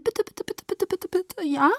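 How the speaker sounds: very high, soft, rapid pleading; squeaky childlike wheedling; excited begging. A narrator's reading. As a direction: repeat it in a tiny high voice, begging. Alice begs while hopping.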